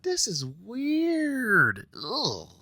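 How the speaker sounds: high, very slow, stretched disgust; drawn-out weirded out. Retold or imitated. Vlogger reacting to the near kiss, very slow and high. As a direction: drag the words out, creeped out. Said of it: "I was like".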